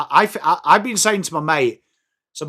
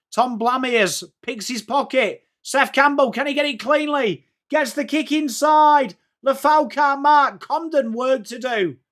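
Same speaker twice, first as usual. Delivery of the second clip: higher, building commentary as the attack unfolds; excited play-by-play; 7 semitones higher than usual. Real, not acted. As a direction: ride the rising tension of the play.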